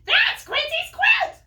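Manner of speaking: very high, very loud quacking duck; squawking cartoon duck, high and loud; loud duck shout. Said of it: "quacks Dottie".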